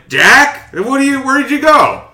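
high-pitched Jimmy Stewart-like hero; hammed-up, high calling after someone lost; John McClane. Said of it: "Apparently Jimmy Stewart was John McClane".

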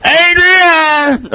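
high far-off cry of Adrian; Rocky calling Adrian. Retold or imitated. Rocky calling for Adrian after winning, high cry. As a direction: call Adrian like the film. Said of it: "we gotta say it".

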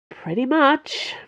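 slow, sighed-out answer; a touch of resignation, slow and sighing. A narrator's reading. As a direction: let it out on a weary sigh. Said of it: "Porthos sighed".

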